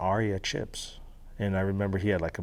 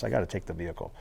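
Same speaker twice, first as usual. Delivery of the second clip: ordinary talk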